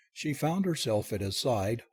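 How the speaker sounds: ordinary delivery, nothing marked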